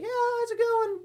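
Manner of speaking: very high-pitched mock child voice; kid voice. Streamer imitating a kid first speaking on Discord, squeaky high. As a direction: pipe up like a little boy. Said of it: "you're, like, a kid kid".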